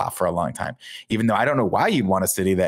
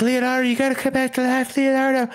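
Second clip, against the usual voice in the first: April pleading. Retold or imitated.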